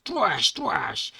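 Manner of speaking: two punched vocal slashes; comic-book battle noise; slash sound effect. Acted. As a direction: mimic blades striking twice. Imitated sword clash noises.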